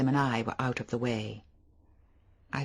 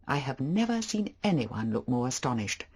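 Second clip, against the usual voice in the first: ordinary delivery, nothing marked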